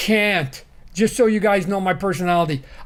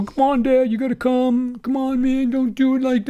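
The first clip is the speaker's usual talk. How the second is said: put-on pleading kid voice, pitched higher; imitating his kids; 7 semitones higher than usual. Dad voicing his kids begging him to come to the movies, higher whiny plea.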